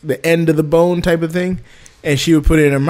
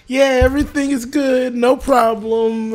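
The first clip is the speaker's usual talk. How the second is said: high, eager, drawn-out reassurance; overly polite, slow and high; forced cheer; 10 semitones higher, slower than usual. Retold or imitated. Reassuring the wife on camera, high and eager. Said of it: "I'm like".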